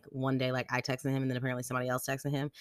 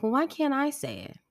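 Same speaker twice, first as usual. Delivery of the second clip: high-pitched imitation of a questioning child; protesting kid; 13 semitones higher than usual. Daughter asking parents about forbidden words, protesting. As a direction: push back in a high kid voice.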